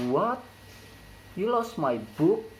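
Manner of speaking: "What" and "book" shoot up high; disbelieving question, steep rise on "What" and "book"; high-rise intonation. Shown as a demonstration. Teacher demonstrating strong surprise for learners, high rise on "What" and "book". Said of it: "The high rise intonation occurs in word".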